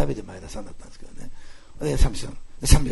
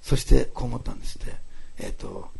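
usual speaking voice

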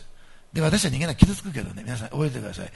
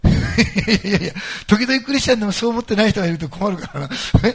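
laughing aside; 7 semitones higher, 6 dB louder than usual. Real, not acted. Laughs at own rhetorical question.

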